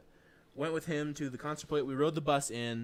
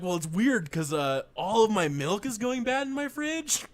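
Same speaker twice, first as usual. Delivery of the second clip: higher, louder, slow on the fridge; exasperated retelling; 8 semitones higher, 5 dB louder than usual. Retold or imitated. Telling friends about the power guy, exasperated and raised. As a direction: pour out the grocery worry. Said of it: "I was like".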